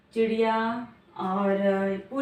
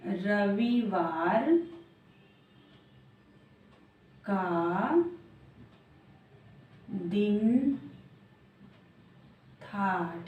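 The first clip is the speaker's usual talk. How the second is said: slow dictation; much slower than usual. Shown as a demonstration. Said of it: "सोच कर बताओ".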